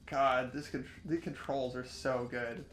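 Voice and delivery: ordinary talk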